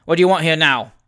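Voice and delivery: loud, insistent demand; forceful, hostile question; confrontational. A narrator's reading. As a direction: press him roughly for an answer. Father repeats hostile question.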